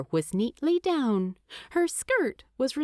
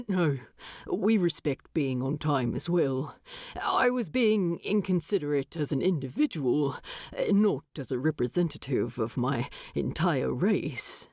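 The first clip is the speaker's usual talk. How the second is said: a lowered, measured dwarf voice; lightly voiced dwarf, lower and even; dwarf Thorin; 6 semitones lower than usual. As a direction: answer politely, a bit lower and dry. Thorin answers Primula.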